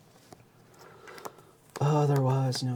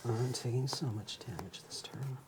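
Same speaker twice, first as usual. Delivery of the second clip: whispering; 13 dB quieter than usual. Real, not acted. Aside under breath.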